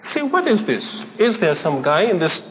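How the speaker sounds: usual speaking voice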